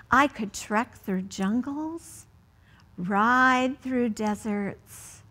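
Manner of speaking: slow, careful picture-book read-aloud; reading to children. Acted. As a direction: read each line slowly to a child at bedtime.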